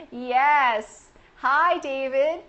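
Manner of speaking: usual speaking voice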